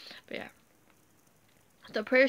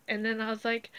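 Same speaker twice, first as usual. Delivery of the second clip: ordinary delivery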